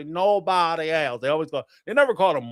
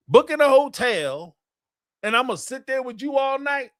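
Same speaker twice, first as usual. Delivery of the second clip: higher, heated mock protest at his wife; retold indignant rant; 7 semitones higher than usual. Husband confronting wife about the Maury invite, heated mock rant. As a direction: replay the fight, fed up and insistent.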